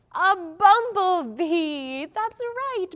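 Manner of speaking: high, drawn-out exclamation; thrilled praise for a right guess; excited reveal. Pretend. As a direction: stretch out the answer, then cheer. Cheering children's correct guess.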